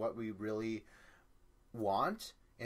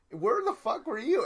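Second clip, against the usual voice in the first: full yell, much higher and louder; retold yell; 12 semitones higher, 10 dB louder than usual. Friends yelling at [name] after she vanished, loud high yell. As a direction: scream the question. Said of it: "yelling at me".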